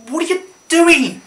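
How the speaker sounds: high-pitched, exasperated cry; mock exasperation. Catching the child doing it wrong, exasperated. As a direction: play it appalled, voice shooting up.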